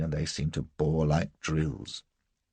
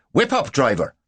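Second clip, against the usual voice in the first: loud, high call to the driver; angry command; 7 semitones higher, 9 dB louder than usual. A narrator's reading. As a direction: order the coachman off angrily. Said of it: "suddenly angry".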